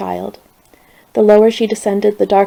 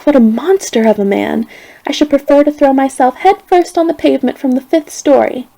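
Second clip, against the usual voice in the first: higher, louder, furious venting; indignant outrage; 5 semitones higher, 5 dB louder than usual. A narrator's reading. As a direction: pour out your outrage. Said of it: "She was furiated".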